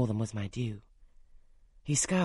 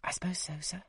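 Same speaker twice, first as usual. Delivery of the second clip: breathless whisper of parting; a soft whispered reply; 5 semitones higher, 7 dB quieter than usual. A narrator's reading. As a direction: barely voice it, sad at leaving.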